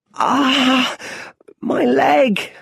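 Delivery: high, loud, hurt cry; cry of pain. A narrator's reading. Little Bruce hurt at the bottom of the well, high, loud pained cry. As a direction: cry out as the leg hits the floor. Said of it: "he cries".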